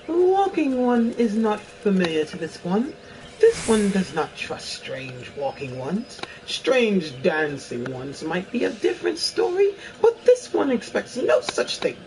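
high, airy fairy speaking formally; lilting fairy creature, aloof. Acted. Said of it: "This is my best fairy voice".

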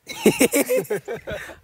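high, loud burst of laughter; real high-pitched giggling burst; laughing fit. Real, not acted. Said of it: "हंस क्यों रहे हो आज".

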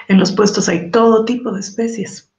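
plain reading voice

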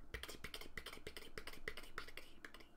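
soft unvoiced rhythmic clatter; whispered wheel rhythm. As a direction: tap it out like train wheels, whispered. Imitates wheels on rails.

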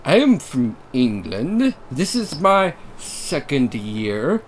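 slow, deliberate British read. Acted. Shane telling narrator where he's from, slow British. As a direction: lay the British on slowly. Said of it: "cycle through accents".

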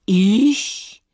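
loud indignant outburst; indignant fox. Acted. Fox jumping up at the hare's accusation, indignant. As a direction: blurt it out loudly, affronted. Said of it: "Der Fuchs sprang empört auf".

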